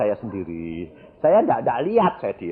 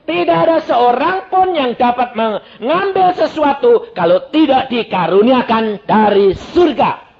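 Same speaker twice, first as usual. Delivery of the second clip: loud, high, declaimed Bible verse; forceful scripture reading; 13 semitones higher, 11 dB louder than usual. Real, not acted. Preacher reads John the Baptist's words to the congregation, loud proclaiming.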